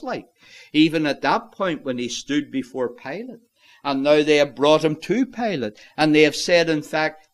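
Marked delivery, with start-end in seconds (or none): none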